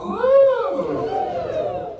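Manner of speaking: squealed high ooh to lead the crowd; a high, delighted crowd ooh. Shown as a demonstration. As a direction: show the crowd the ooh they should give. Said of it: "Everyone go".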